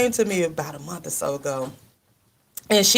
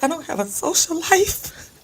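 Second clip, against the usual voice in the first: higher, whiny mock lament from the niece; imitating a wail; 10 semitones higher than usual. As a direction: mimic the niece's tearful complaint. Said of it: "she said".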